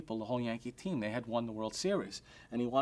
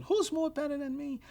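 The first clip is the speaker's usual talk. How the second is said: high, pitched-up mock tantrum yell; retold screaming. Billy Martin yelling at the restaurant owner, high shrieking rant. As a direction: squeal it like an outraged ballplayer. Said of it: "He started screaming".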